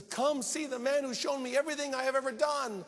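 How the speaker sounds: high, loud amazed summons; excited calling out. Acted. Preacher voices the woman running into town, excitedly calling. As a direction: call the whole town over excitedly. Woman calls townspeople in retelling.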